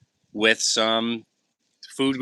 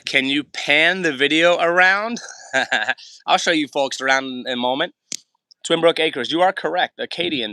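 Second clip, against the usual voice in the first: ordinary talk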